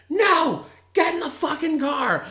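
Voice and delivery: yelling at the top of lungs; furious shout. Retold or imitated. Yelling at drunk brother.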